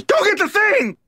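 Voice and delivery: high-pitched, bossy yell; shouted order. Acted. Ant at the hole ordering the others, high yelled command. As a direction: call out an order from far back.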